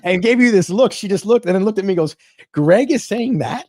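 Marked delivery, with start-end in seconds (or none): 2.5-3.7 slow, higher incredulous question; drawn-out, lightly acted astonishment; mock disbelief. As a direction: imitate someone taken aback. Acts out Morella's disbelieving reaction.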